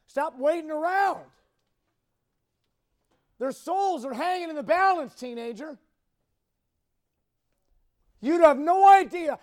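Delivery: loud, high-pitched warning with weighty pauses; urgent preaching. Real, not acted. Preacher warning teenagers that souls hang in the balance, urgent exhortation. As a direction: ring it out to the congregation, urgently.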